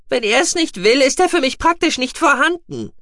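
very high, loud piping voice; helpless mousebeaver. A narrator's reading. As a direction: explain high and insistently. Guki helplessly explains. (sehr hohe, laute Mausbiberstimme, hilflos)

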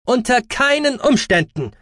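high, loud refusal; called out. Acted. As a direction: shout it down indignantly. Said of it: "rief Aspartamin".

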